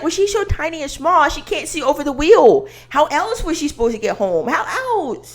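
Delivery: simpering fan voice. Retold or imitated. Mocking fans excusing her crimes in a high sweet voice. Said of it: "in that exact voice".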